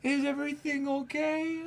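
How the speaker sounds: put-on tiny, very high child voice; concerned little kid. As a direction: imitate a small child gently checking on someone. Kid hugging, checking on sad adult.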